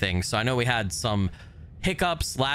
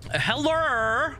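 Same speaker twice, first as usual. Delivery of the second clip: high call. Real, not acted. Calling out in empty monastery.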